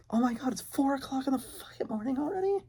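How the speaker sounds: high, startled exclamation; shocked. Real, not acted. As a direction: suddenly realize how late it is. Notices time, shocked.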